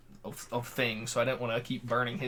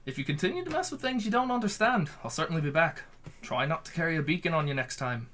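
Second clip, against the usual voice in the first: high Irish fae lilt; lilting Irish accent, mischievous; 4 semitones higher, faster than usual. Acted. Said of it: "I like to have somewhat of an Irish accent for him".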